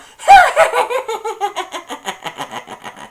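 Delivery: long, loud, high hammed-up laugh; high mock Grinch laugh. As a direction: cackle it off at the mayor.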